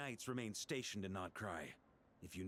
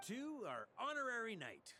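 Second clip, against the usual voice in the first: echoing the toast; 11 semitones higher than usual. Acted. Varka echoes the toast.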